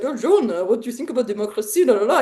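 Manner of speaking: lightly voiced foreigner, quick and breezy; tossing off the question with a carefree la la la; mock French traveler. Imitating a French guy asking his American neighbor about democracy, lightly mocking. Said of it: "a French guy comes and is like".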